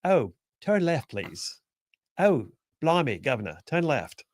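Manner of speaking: mock British satnav lady; British accent. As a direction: put on a slow, hammy British accent. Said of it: "had a British accent".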